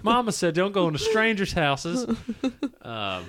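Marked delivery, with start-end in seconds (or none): 0.0-2.0 put-on high, innocent little-boy voice; earnest kid quoting his mama; wholesome 1950s kid. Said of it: "it's just a small boy".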